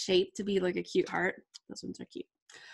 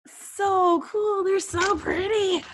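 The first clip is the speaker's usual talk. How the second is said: much higher, gushing delight; delighted squeal; 11 semitones higher, 6 dB louder, slower than usual. Real, not acted. Vlogger gushes to viewers over tiny acrylic stars, delighted squeal.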